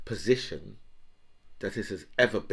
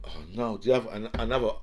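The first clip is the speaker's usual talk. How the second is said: ordinary talk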